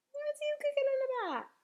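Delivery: high-pitched, miffed question; slightly nettled. A narrator's reading. BFG catching Sophie smiling, slightly nettled. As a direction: ask it put out, pitch jumping up. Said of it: "slightly nettled".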